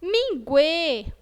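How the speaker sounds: firm closed Ê in Minguê; closed vowel. Shown as a demonstration. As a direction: keep the mouth narrow on the end of Minguê. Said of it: "o som também é fechado".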